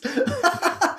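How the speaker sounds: high peal of laughter; amused laugh. Real, not acted. Gardener laughing over mystery plants, a high laugh. Laughs at her own story.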